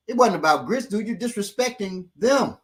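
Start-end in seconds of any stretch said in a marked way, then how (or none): none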